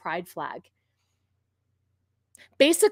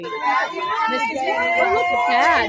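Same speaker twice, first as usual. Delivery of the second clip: high, drawn-out fake delight; mock teacher gush; 6 semitones higher, 3 dB louder, slower than usual. Teacher greeting a costumed colleague, mock high gushing. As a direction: coo over the costume like a sugary teacher. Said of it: "This is your teacher welcoming you in".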